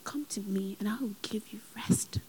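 hushed voice of God; whispered. Retold or imitated. God comforting the crying speaker, whispered. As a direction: whisper it tenderly. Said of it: "And God said".

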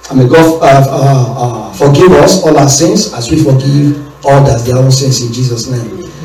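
normal talking voice

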